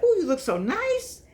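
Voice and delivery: high, delighted praise; quoting a cheerful high compliment; gushing compliment. Retold or imitated. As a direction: gush over how nice she looks. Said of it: "And I said".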